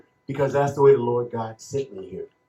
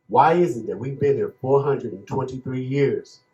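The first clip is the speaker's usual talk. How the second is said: ordinary talk, nothing marked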